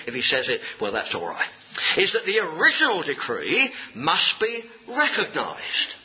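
ordinary talk

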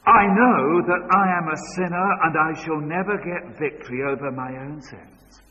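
a hint of the earnest convert; earnest sinner's confession. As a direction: speak it like a heartfelt confession. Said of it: "they would say something like this".

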